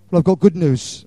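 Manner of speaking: normal talking voice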